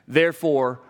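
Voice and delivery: normal talking voice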